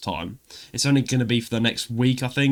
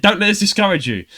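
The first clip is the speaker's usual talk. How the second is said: high, loud cheery reassurance; mock encouraging employer; 8 semitones higher, 5 dB louder than usual. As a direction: imitate the upbeat rejection letter. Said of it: "they said".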